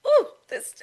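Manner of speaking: excited squeal. Retold or imitated. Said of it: "I'm like".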